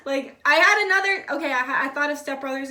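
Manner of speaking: loud, eager jump to a new idea; excited burst. Real, not acted. Reader excited by a movie memory, loud and eager.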